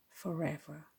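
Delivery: usual speaking voice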